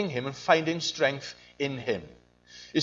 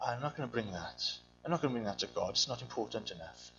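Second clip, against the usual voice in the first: much softer, lower, brushing it off; dismissive inner voice; 7 semitones lower, 12 dB quieter, faster than usual. Retold or imitated. Believer dismissing their pain, softer and lower. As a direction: mutter it away to yourself. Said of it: "insignificant thing".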